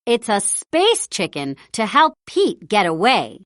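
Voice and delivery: usual speaking voice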